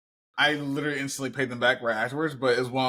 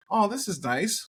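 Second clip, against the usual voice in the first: much higher, light mock delight; pleased surprise; 9 semitones higher than usual. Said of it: "I'm just like".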